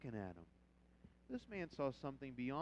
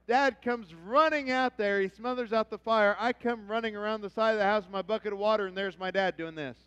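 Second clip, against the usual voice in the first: loud, animated preacher's story; preaching storytelling; 7 semitones higher, 16 dB louder than usual. Acted. Pastor telling his congregation a loud boyhood story. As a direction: tell it big from the pulpit.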